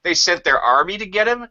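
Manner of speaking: put-on high, excited child explaining; young son explaining to his dad, high and eager; kid voice. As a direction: rattle it off like a puzzled boy. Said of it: "I said".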